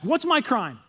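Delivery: acted outrage of the wrongly accused; angry. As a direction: demand it loudly, pitched high. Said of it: "Jacob was angry".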